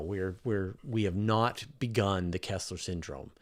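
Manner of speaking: normal talking voice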